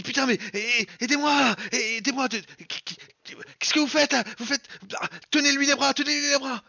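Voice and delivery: high frantic shouts for help; panicked yelling. Acted. GM voices Flynn panicking. (cris paniqués)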